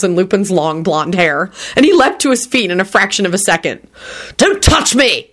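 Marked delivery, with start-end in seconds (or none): 4.4-5.3 high, contained cry of horror; horrified shout. A narrator's reading. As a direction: recoil with a mild shout. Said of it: "he shouted with a horrified expression".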